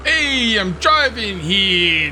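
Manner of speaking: loud, high, drawn-out New York yell; New York accent. Acted. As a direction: holler like an irate New York driver. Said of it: "Do they talk like that in upstate New York?".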